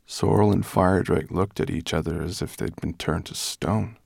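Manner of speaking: plain reading voice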